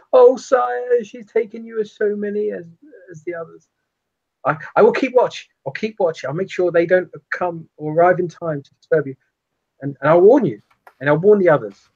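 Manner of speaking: high, fawning servant voice; rat servant voice. Acted. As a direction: grovel to your master like a rat. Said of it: "individual known as, as the".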